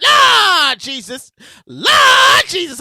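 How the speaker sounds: loud, long-drawn mock cry to heaven; exclaiming. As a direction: stretch out LORD in hammed-up alarm. Exclaiming over the storm story.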